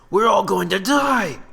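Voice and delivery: high, loud, slow wail; panicked soldier. Acted. Terrified soldier to everyone, wailing. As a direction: wail it out in terror.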